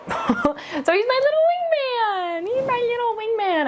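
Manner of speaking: high-pitched cooing over a puppy; gushing pet talk. Real, not acted. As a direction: coo it high and slow, adoringly.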